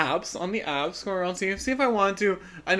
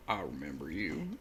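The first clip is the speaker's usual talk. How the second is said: very low, hushed country creep; mock deep country accent, barely voiced; 14 semitones lower, 16 dB quieter than usual. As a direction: drop deep and quiet, mimicking a creepy country man. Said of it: "in this deep country accent".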